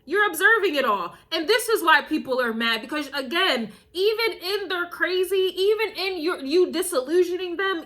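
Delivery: ordinary talk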